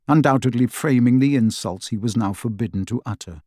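plain reading voice

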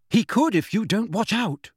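high-pitched indignant warning; angry. A narrator's reading. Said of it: "said Hermione angrily".

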